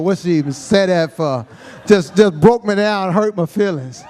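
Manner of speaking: ordinary delivery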